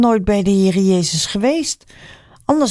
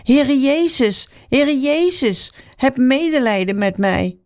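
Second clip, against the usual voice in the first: calling out; 6 semitones higher than usual. A narrator's reading. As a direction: call out from far off for help. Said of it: "begon Bartimaeus te roepen". (blinde man roept smekend naar Jezus, hoog en langgerekt)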